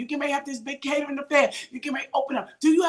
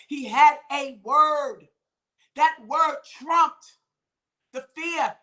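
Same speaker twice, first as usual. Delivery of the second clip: slow emphatic preaching; 4 dB louder, slower than usual. Acted.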